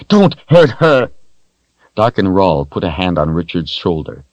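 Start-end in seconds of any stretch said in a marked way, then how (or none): 0.1-1.1 high and louder, near a cry; desperate pleading. Acted. Richard pleading with Darken Rahl, high loud begging. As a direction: beg with everything. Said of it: "pleading up to him".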